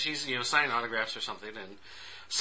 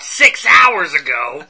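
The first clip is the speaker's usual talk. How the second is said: suddenly loud, indignant complaint; loud, aggrieved punchline; exasperated outburst; 13 dB louder, much slower than usual. Retold or imitated. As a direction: blurt it out loudly in mock outrage. Mock outrage at late news.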